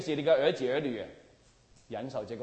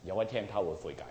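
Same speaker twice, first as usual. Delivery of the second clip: normal talking voice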